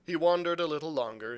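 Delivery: ordinary delivery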